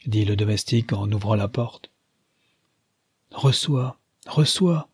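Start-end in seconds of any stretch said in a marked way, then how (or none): 3.3-4.9 mild, higher-pitched call on the repeat; crying out. A narrator's reading. A gentleman hastily telling his servant to receive the visitor, crying out. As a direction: call out urgently without really shouting. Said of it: "cria le digne homme".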